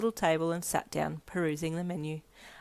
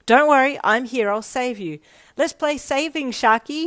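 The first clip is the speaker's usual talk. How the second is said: raised, loud call over a panicked crowd; yelled back; 7 semitones higher, 10 dB louder than usual. A narrator's reading. Friend calls over the din of panicked school members, yelling. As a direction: yell it back, high and urgent to carry. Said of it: "he yelled back".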